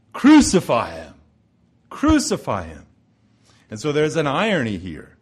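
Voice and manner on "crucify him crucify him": loud mock crowd chant of Crucify him; crowd crying out. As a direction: call it out like an angry mob, louder. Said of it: "And they cry out".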